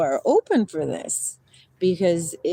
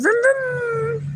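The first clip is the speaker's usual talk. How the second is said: high playful engine noise; vroom boat noise. Pretend. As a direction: play at being a boat going by.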